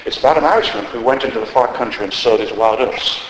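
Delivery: ordinary talk, nothing marked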